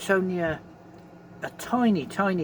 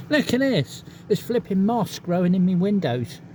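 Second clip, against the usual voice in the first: amused exclamation; 5 semitones higher, 6 dB louder than usual. Real, not acted. Amused at moss in windows.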